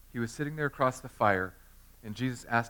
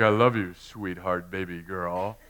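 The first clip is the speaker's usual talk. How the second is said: lower, crooning mock sweet talk from a husband; 5 semitones lower, 3 dB louder, slower than usual. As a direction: croon it like a husband buttering up his wife. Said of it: "all the husbands are like".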